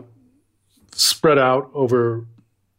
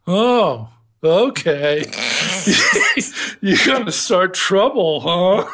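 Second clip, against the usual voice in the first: higher, laughing mock-calm taunt; amused Russian fighter; 6 semitones higher, slower than usual. Russian fighter to the macho American, calm and amused, laughing through it. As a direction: shrug it off, chuckling, then needle him. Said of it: "the Russian guy kind of blinks and goes".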